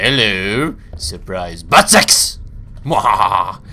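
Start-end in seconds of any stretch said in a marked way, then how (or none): 1.6-3.6 mad scientist. Acted. Evil gag after reveal.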